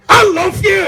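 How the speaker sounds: high shouted I love you; hammed-up shrieked declaration; wife's shriek. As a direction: screech it like a fierce Glaswegian wife.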